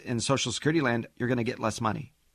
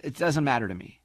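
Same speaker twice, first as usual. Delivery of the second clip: normal talking voice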